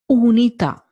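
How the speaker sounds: unità with a pure oo and stressed final à; unità, Italian U. Shown as a demonstration. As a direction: say unità, oo not you. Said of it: "U and U in English and in Italian are different".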